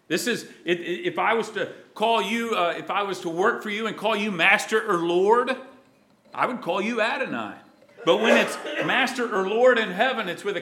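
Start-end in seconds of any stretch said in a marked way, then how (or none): none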